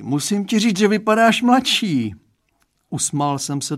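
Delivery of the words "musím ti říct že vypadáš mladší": high-pitched, louder yawny remark; yawning. A narrator's reading. Father telling daughter's boyfriend he looks younger, yawning. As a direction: talk up high through a yawn. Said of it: "zívnul pan Stránský".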